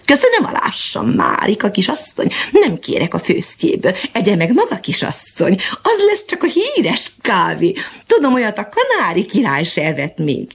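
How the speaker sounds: chirpy high teasing bird voice; mocking canary. The canary mocks Mariska's coffee, high and teasing. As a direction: squeak out the jeers like a cheeky canary. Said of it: "csúfolódva pittyentette el magát".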